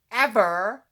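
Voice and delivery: ordinary delivery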